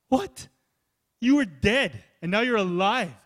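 sharp high "What?"; incredulous. Real, not acted. Preacher stunned anyone finds conversion boring, loud and high. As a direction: jolt them awake with disbelief.

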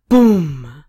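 loud, drawn-out boom; voiced explosion; imitated explosion. A narrator's reading. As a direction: let it burst and fall away.

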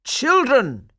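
high, loud call for attention. Acted. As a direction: call the crowd to order. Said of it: "he cried".

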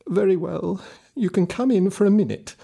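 put-on high old woman's voice, giving in; old woman relenting. A narrator's reading. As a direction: let him in, a little reluctant.

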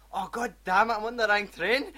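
panicked shout. Retold or imitated. A man on the wrong train, loud panicked shout. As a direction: yell like you just missed your stop. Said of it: "And next thing you know".

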